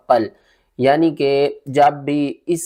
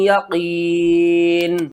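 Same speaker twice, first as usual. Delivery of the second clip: drawn-out tajweed ending; recitation; much slower than usual. Shown as a demonstration. Teacher reciting, slow tajweed ending. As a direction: hold the ending long.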